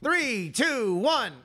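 high, bright countdown call; mock countdown. Cohost counts down to the musical, high and excited. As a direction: count it down like a launch.

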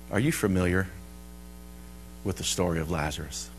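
soft, low retold question; hushed, low inquiry; gentle question. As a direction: ask it gently of someone grieving. Retells gentle question to grieving woman.

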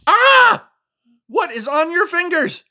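high, loud outraged frog; frog scolding. Acted. Finn the Frog scolds the reader for cheesy puffs, high and loud. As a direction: scold the reader for the messy fingers.

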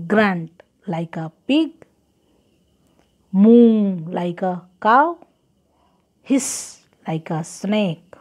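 ordinary talk

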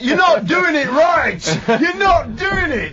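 high, slow furious yell; angry teacher shout. Retold or imitated. Elvis impersonator yells at his cellmate, furious. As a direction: bellow at the failing pupil. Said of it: "He goes".

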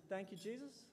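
imitating a hesitant athlete, soft and questioning; baffled echo. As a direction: repeat it quietly, rising into an unsure question. Baffled athlete repeats coach's words.

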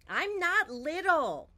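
put-on child's loud protest at the grocery store; indignant outburst. A narrator's reading. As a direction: protest it, fed up. Frustrated refrain after sticker offer.

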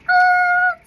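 high squealing "Ah" like slamming brakes; a sudden high yelp of alarm; shrill braking yelp; mock shriek. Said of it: "I'll just be like".

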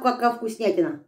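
ordinary talk

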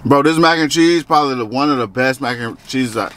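speaking between bites; chewing while talking. Real, not acted. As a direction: mouth partly full. Seen 1: Bite at -5, lips pursed and working through 4.4.

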